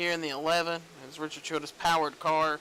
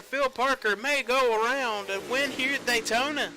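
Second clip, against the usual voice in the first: excited call of the winner crossing the line; finish-line call; 5 semitones higher than usual. Real, not acted. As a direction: sell the final lap to the crowd. Calling the finish at Daytona.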